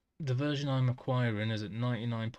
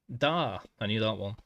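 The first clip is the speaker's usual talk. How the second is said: normal talking voice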